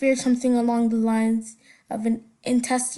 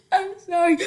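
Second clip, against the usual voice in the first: higher, louder, laughing sob-hiccup apology; stuttering hiccup cry; 6 semitones higher, 4 dB louder than usual. Shown as a demonstration. Child crying after being scolded by mom, hiccup sob demo. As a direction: show the weird cry-stutter-hiccup noise. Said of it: "like this".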